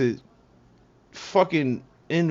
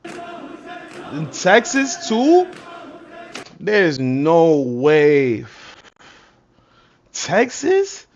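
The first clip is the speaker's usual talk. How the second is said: disbelief; 2 semitones higher, 4 dB louder, much slower than usual. Real, not acted. Shocked disbelief at news.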